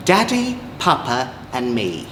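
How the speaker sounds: usual speaking voice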